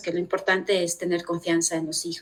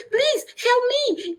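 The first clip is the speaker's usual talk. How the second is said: pleading; 14 semitones higher, much slower than usual. Retold or imitated. Pleading for the lost suitcase.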